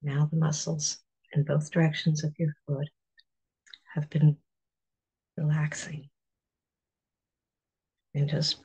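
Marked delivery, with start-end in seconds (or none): none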